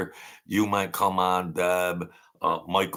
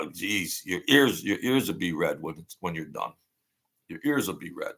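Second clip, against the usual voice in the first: ordinary talk, nothing marked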